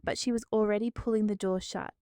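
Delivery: ordinary delivery, nothing marked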